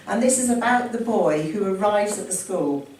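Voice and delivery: usual speaking voice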